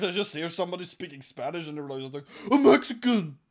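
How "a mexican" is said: high, very loud accusing shout; hammed-up panicked exclamation; mock alarmed cry. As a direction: yell it like spotting a fugitive. Acts out agent's alarmed cry.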